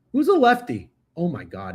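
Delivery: usual speaking voice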